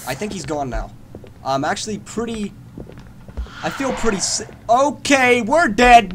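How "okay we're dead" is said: alarmed outburst. Real, not acted. Sudden threat in horror game.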